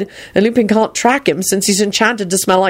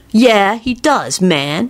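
sulky; 2 semitones higher, much slower than usual. A narrator's reading. Kenny contradicting the father, sulky. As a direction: argue back in a slow pout. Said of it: "Kenny replied sulkily".

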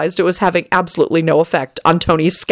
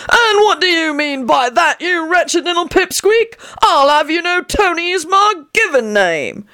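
put-on high, slow outraged old codger; indignant old man; 11 semitones higher than usual. A narrator's reading. Old man insulted by Tony, high indignant voice. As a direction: bristle like an offended old crank.

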